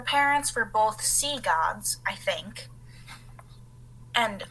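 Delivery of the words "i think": breathy whispered afterthought; whispered aside. Real, not acted. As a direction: drop to a whisper for the hedge. Quiet aside after a guess.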